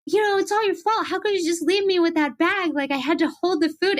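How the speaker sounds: hammed-up blame at her sister; mock yelling. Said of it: "I yelled at her".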